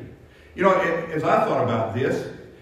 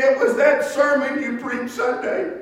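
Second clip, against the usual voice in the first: much higher and louder, a mild imitation of a man crying out his confession; tearful confession; 7 semitones higher, 5 dB louder than usual. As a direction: voice the man breaking down over being lost. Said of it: "he was just crying like a baby".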